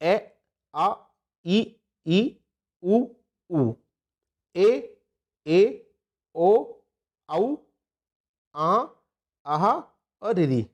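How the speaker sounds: each vowel sounded out separately; teacher pronounces each vowel alone; reciting the vowels. Shown as a demonstration. Teacher reciting the vowel list to students, sounding each one out. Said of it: "कौन कौन से एक बार देख लेते हैं".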